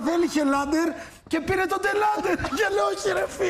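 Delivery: excited high retelling. Retold or imitated. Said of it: "Έγραψα".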